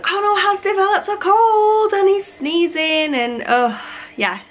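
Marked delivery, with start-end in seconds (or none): none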